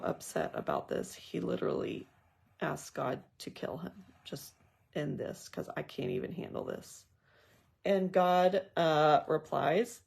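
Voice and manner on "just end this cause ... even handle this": softer, hushed and defeated, nearly a whisper; weary plea. Retold or imitated. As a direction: sigh it out as if you can't go on. Jonah begging God to end it.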